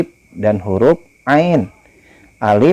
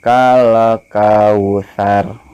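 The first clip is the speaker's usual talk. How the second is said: slow, melodic chant with long vowels; Quran recitation; much slower than usual. Shown as a demonstration. Teacher models the verse for pupils, slow chant. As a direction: recite slowly, stretching each vowel. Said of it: "simak Bapak membaca surah Al-Kausar".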